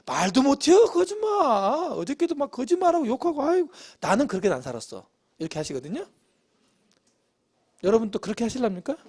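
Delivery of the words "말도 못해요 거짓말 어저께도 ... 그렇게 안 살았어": blame-shifting gossip. Acted. Evangelism contact blaming a neighbour to preacher, mimicked gossip. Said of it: "갑자기 김씨 아줌마 끌고 와가지고".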